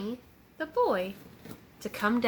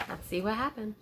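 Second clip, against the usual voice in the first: plain reading voice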